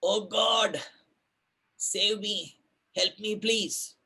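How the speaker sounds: crying out. Retold or imitated. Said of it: "So he cries out".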